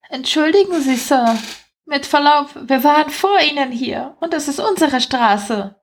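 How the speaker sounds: high, mannered little rat voice; politely objecting rat. Acted. Rat claiming its street from Spencer, politely, high voice.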